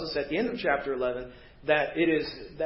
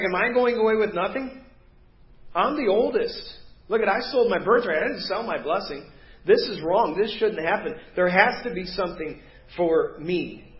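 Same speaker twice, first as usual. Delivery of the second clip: passionate crying out; 3 semitones higher, 4 dB louder than usual. Acted. Esau protesting to his father over the lost blessing, crying out passionately. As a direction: plead with heated, punched indignation. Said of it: "he's passionately crying out to his father".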